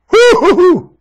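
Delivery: high, loud woo-hoo cheer; gleeful whoop. Real, not acted. Cheering about the afterlife, high and loud. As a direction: whoop with joy.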